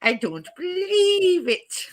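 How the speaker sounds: high, slow catchphrase with a long BELIEVE; Victor Meldrew. Retold or imitated. Copying co-host's impression, Victor Meldrew catchphrase. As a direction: mimic a grumpy sitcom catchphrase. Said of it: "I am not Victor Meldrew".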